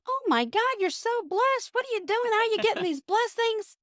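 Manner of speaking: gushing neighbor. Acted. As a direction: squeal over the neighbor's blessings. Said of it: "The neighbor comes over and goes".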